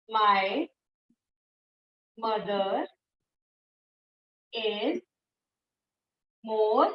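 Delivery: low voice, long pause after every word; measured, separated words for note-taking; word-by-word dictation. Shown as a demonstration. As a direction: dictate it one word at a time. Teacher dictates example word by word.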